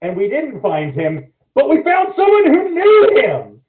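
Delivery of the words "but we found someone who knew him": loud, high-pitched exclamation; excited reveal. Real, not acted. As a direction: burst out with the big twist, voice way up. Triumphant high point of the story.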